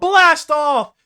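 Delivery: high, loud launch cry; mock group shout. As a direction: yell it as the ship takes off. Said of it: "everyone just shouting out".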